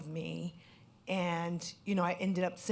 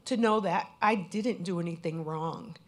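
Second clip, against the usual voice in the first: ordinary talk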